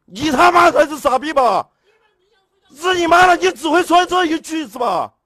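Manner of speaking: yelling back hard and high, full fury; real heated quarrel shouting match; furious shouting. Real, not acted. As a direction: scream it at the neighbour upstairs.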